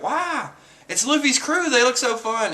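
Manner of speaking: put-on high, delighted girlish exclamation; mock wonder. Acting out Margaret's delighted cameo.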